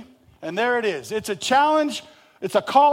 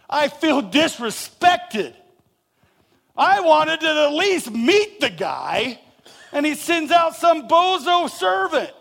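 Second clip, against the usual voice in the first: higher, slow, indignant complaint; offended grumbling; 7 semitones higher, 5 dB louder, slower than usual. Retold or imitated. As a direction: play the slighted general, slow and higher. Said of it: "what is Naaman really saying?".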